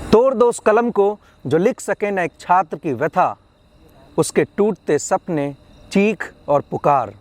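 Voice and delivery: rousing, measured protest verse; protest poem recitation. Acted. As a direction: declaim each refrain, pausing before the next phrase.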